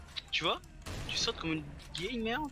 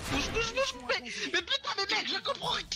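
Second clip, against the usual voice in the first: frustrated high-pitched outburst; 13 semitones higher than usual. Real, not acted. Frustrated gamer yelling at screen.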